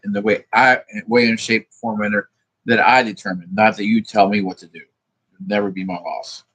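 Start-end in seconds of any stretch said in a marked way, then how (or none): none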